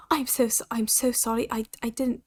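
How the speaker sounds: put-on quiet British-accented apology. Player voicing Cass apologizing, slipping into a British accent. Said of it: "that's British".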